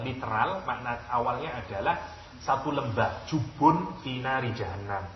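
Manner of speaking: ordinary talk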